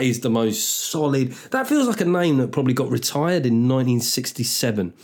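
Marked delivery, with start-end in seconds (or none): none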